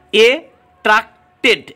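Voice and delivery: "এ ট্রাক্টেড" broken into slow, separate syllables. Shown as a demonstration. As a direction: say "এ ট্রাক্টেড" in parts, pausing after each. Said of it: "syllable রয়েছে". ("এ ট্রাক্টেড" থেমে থেমে, syllable ভেঙে)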